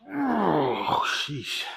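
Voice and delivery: strained and winded after a heavy set; effort grunt. Real, not acted. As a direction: groan it out, spent from lifting. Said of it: "Sorry you have to hear me grunting".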